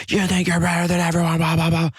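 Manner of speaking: high-pitched imitation of a ranting man; mock yelling. Barfly ranting at the non-drinker, mock yelling. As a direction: rant in a high mock yell, tailing into blahs. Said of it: "And he starts yelling".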